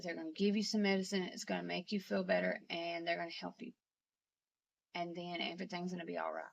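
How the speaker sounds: soft, subdued retold comforting; tender low-voiced reenactment; gentle reassurance. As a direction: quietly promise a sick parent relief. Daughter gently reassures her dying father.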